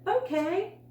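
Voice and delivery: a high, loud, eager okay; enthusiastic. A narrator's reading. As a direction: burst out with a child's eagerness. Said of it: "With enthusiasm".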